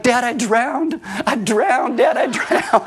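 put-on high, wailing little-girl voice, half laughing; mock sobbing little girl. Daughter pulled from the pool wails to dad, mock sob. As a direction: play the soaked kid crying to dad, hammed-up. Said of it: "she's sobbing".

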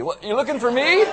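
high, loud zombie rising from the grave. Retold or imitated. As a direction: play a puzzled zombie, hammed up. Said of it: "like a zombie movie".

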